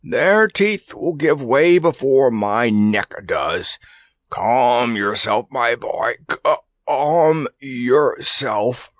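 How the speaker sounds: slow, laboured elephant voice; gamely grunted. A narrator's reading. Kabumpo calms Randy while being choked, grunted gamely. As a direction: reassure slowly, straining over drawn-out words. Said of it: "he grunted gamely".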